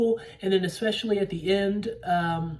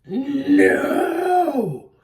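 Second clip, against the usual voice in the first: ape's furious shout; 10 semitones higher, 8 dB louder than usual. Retold or imitated. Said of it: "And he goes".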